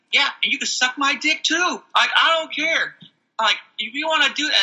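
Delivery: high-pitched, heated comeback; defiant retort. Retold or imitated. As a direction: throw it back at the coworker, unbothered. Retold heated retort to coworker.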